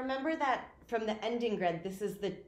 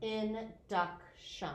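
"Induction" said slowly with every syllable clear; slow, careful repeat; 3 semitones higher, much slower than usual. Shown as a demonstration. Student repeating the word for the teacher, slow and careful. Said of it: "Say it one more time".

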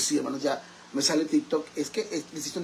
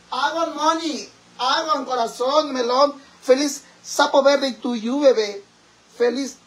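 mock birthday shout-out; 9 semitones higher, 6 dB louder than usual. Birthday greeting, performed.

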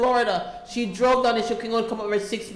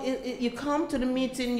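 ordinary talk

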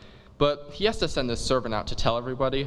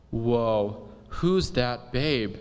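smitten dude; much slower than usual. Acted. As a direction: drawl it like a stunned surfer guy. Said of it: "He sees Juliet and goes".

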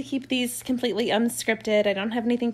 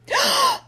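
put-on sharp high gasp of a child; a quick, high-pitched surprised gasp; imitated gasp; 6 dB louder than usual. Mom imitating her son's surprised gasp, high and sharp. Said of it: "I hear him say".

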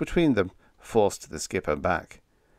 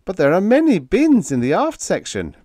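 high, loud, urgent plea; pleading; 11 semitones higher, 8 dB louder than usual. A narrator's reading. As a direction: plead your case anxiously. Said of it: "Biggs pleaded".

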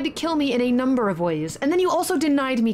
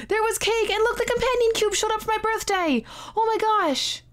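thrilled; 8 semitones higher than usual. Real, not acted. Thrilled at seeing the cake.

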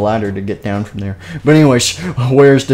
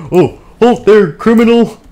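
commanding halt; 10 semitones higher, 6 dB louder than usual. Pretend. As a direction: cry halt like a cartoon guard. Guard spots the intruder.

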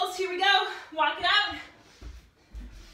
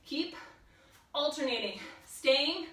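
ordinary talk, nothing marked

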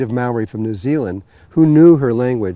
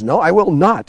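higher, firm mock-heated refusal; retold screaming match; 5 semitones higher, 4 dB louder than usual. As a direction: act out digging in during a row. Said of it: "they're screaming at each other".

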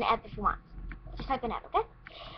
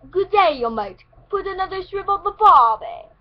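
a put-on British accent, higher and louder; girl faking a British accent, high and loud; fake British accent; 8 semitones higher, 10 dB louder than usual. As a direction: show off a pretend British accent. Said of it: "she talks like this".

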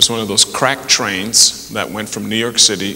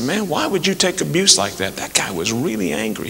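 bystander's question; 8 semitones higher, 4 dB quieter than usual. Retold or imitated. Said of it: "And he said".

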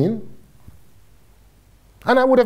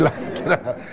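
ordinary talk, nothing marked

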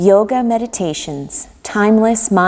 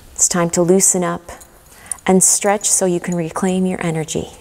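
calm guided meditation with long pauses. Acted. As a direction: guide them gently into relaxation, leaving room to breathe.

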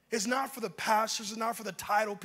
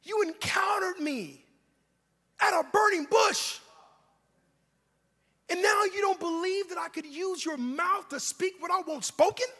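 indignant God; 10 semitones higher, 6 dB louder than usual. Acted. God reminds Moses of the burning bush, indignant. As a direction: scold in a high voice, building to loud. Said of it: "he said".

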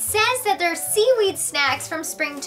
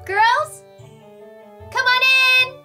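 high call, held at the end; 6 semitones higher, 3 dB louder, slower than usual. Acted. Elsa to unanswering friends at the door, calling out. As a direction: call out to guests who haven't answered.

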